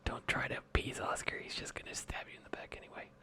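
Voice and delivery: whispering. Real, not acted. Aside whispered about Oscar.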